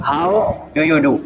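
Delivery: very slow "How do you do" drawn out word by word; slow accent demo. Shown as a demonstration. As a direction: stretch each word out slowly. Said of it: "But गोरे इसे किसे, कैसे बोलते हैं".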